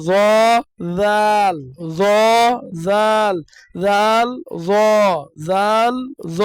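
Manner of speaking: জ said full and heavy, জাল said thin and light; heavy and light letters. Shown as a demonstration. Teacher contrasting heavy and light letters for the class. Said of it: "পুর অর্থ মোটা, বারিক অর্থ পাতলা".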